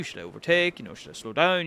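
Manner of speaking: normal talking voice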